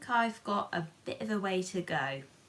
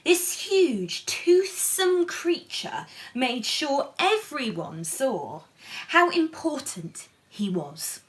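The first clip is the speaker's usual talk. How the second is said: storybook narration; 3 semitones higher, 5 dB louder, slower than usual. A narrator's reading. Reading the picture book aloud to children, animated. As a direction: lift the story up for the little ones.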